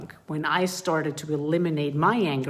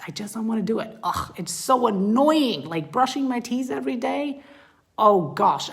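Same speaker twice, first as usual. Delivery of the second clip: exasperated complaining; 6 semitones higher than usual. Acted. Parent commiserating with child over brushing teeth, exasperated. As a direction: groan it out like a fed-up kid.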